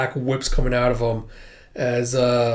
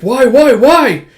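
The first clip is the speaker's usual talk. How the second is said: high, loud frantic cries; horrified, loudly acted wailing; 11 semitones higher, 12 dB louder, slower than usual. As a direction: repeat it in mounting horror.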